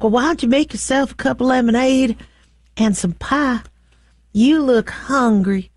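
lightly put-on Southern accent, a laugh at the end; a mock Southern charmer offering pie, Southern accent; Southern hostess voice. AI companion coaxing her husband to eat, light Southern accent. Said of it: "he'd probably like the Southern accent".

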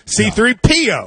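C three P O with P punched; C three P O with clear weight on P, letters apart; stress on P. Shown as a demonstration. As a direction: lean on the P of C three P O. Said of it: "Mine's on the three. P".

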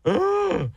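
high gasp into a grossed-out groan; mock retching "ugh"; disgusted ugh. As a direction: recoil from awful food. Disgust at remembered vegetable dish.

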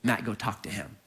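low, calm commanding voice; inner voice. Retold or imitated. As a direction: give the order low and measured, like a voice in his head. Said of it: "there was this voice that said".

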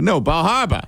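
"Bar Harbor" as "bah hahr-buh"; insistent Boston-accent attempt. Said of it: "I said".

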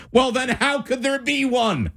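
rising pitch, mock disbelief; incredulous scoff. Real, not acted. Host mocking the AI's answer, incredulous. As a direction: scoff at the claim, voice climbing.

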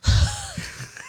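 real laugh bursting out high; burst of laughter. Real, not acted. Laughing at cohost's impression.

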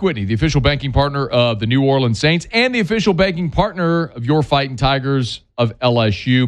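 upbeat sponsor read. Acted. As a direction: read it like a live radio ad.

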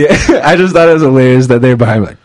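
ordinary talk

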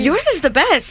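usual speaking voice

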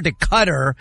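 Qatar said like "cutter", stress up front; alternate pronunciation. Shown as a demonstration. TV host showing name changes, Qatar said "cutter". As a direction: say Qatar to rhyme with butter. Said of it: "different pronunciations".

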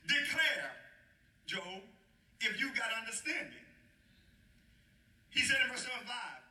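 rising sermon reading of God's questions; preaching scripture. Acted. Preacher voicing God questioning Job, ringing sermon delivery. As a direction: press Job's questions like a preacher.

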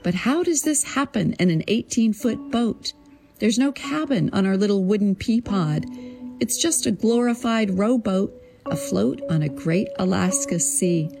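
ordinary delivery

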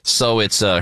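ordinary talk

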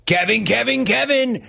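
high sing-song name call. Acted. Host calling out the caller in a high sing-song. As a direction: chant the name up high like a bit.

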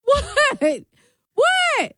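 squeaky high what; high disbelief. Real, not acted.

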